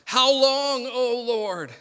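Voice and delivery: high, drawn-out plea to God; lamenting cry. Acted. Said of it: "Habakkuk's cry".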